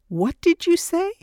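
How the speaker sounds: very high, rising incredulous question; stunned disbelief. Acted. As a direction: ask in shock, voice climbing steeply. Asks again after shocking news.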